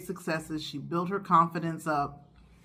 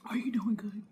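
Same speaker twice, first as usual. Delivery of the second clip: whisper; 3 semitones higher, 5 dB quieter than usual. Real, not acted. Repeat as soft whisper.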